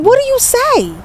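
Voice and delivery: high-pitched, stunned disbelief; high incredulous question; shocked. Retold or imitated. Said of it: "I'm still shocked behind it".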